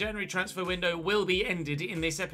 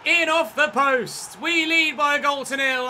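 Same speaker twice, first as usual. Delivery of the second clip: much louder and higher, slowed celebration; excited goal call; 8 semitones higher, 9 dB louder than usual. Real, not acted. Gamer celebrating Ollie Watkins' goal: excited loud call. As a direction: call the goal like a thrilled commentator.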